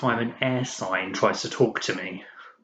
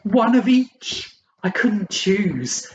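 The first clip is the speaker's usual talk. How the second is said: high, loud, drawn-out glee; gleeful shout; 10 semitones higher, 4 dB louder, slower than usual. Acted. Date boasts of her pets in a restaurant, gleeful shout. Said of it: "she gleefully shouted".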